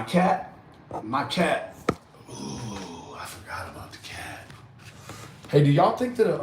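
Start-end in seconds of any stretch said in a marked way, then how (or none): 2.3-4.5 sudden hushed whisper. Real, not acted. Telling viewers he forgot about the cat, whispering. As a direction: drop to a whisper as it dawns on you.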